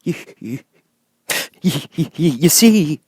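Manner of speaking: high, slow stammering whimper of a lion. A narrator's reading. Timid lion to friends, stammering whimper. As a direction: stutter it out, timid and whining. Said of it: "with a whimper".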